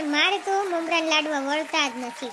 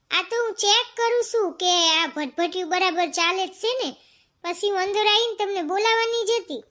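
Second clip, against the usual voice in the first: usual speaking voice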